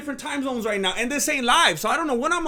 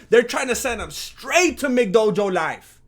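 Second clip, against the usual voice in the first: usual speaking voice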